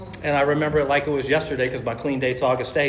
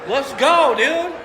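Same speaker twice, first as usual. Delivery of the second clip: louder and higher hype call; hyped up; 12 semitones higher, 6 dB louder, much slower than usual. Retold or imitated. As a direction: shout it like a rally cry, pumped. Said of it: "So I'm hyped up".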